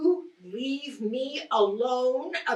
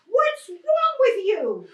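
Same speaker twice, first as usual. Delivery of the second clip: exasperated; 8 semitones higher, 4 dB louder than usual. Acted. Wife snaps at questioning husband.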